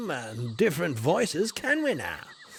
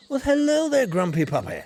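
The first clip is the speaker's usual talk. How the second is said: loud, high sing-song hello; hearty greeting; 4 semitones higher, 5 dB louder than usual. Acted. Explorer hails Child Crone, loud and high. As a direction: call out a big cheery welcome.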